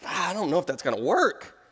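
mock disbelief, pitch jumping up on the questions; incredulous God. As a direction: play an incredulous God, stretching the key words. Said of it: "if I were God, I would just look at it and go".

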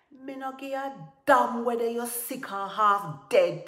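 high, loud, impatient outburst; angry shout. A narrator's reading. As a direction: shout down his excuses. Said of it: "Tiger shouted".

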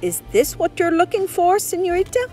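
high, lilting call; calling to her. A narrator's reading. Manager calling Lisa over to see what he found, calling. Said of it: "she heard the manager call her".

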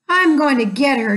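ordinary delivery, nothing marked

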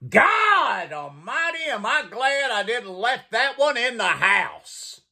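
high, hollering grandpa scream; hammed-up high-pitched old grandpa holler. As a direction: scream it out in comic shock. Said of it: "he screamed".

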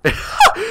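sudden shrieking burst of laughter; high squealing laugh. Real, not acted. High laugh as the noob falls off.

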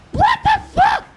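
very high, loud shriek; outraged shout. Real, not acted. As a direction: shriek it in shock at the game. Sudden outburst at the game.

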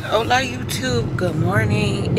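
vlog greeting. Acted.

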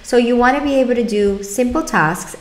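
ordinary delivery

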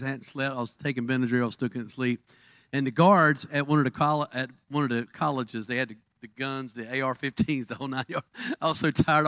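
ordinary talk, nothing marked

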